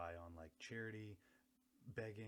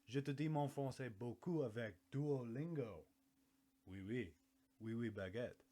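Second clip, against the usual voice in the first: put-on French accent; 3 semitones higher, 7 dB louder than usual. Mock Duolingo French.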